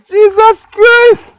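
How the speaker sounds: high drawn-out cry of shock; shocked exclamation. Acted. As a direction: stretch it out in disbelief. Dramatic greeting, shouting in shock.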